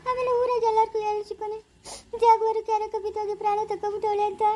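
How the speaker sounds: high, wailing sobbing plea; crying. Acted. Jaguar to Chikkanna, threatening the lake, high and sobbing. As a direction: cry it out, threatening to jump in the lake. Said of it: "ಯಾಕೆ ಜಾಗ್ವಾರ ಅಳ್ತಾ ಇದ್ದ್ಯಾ?".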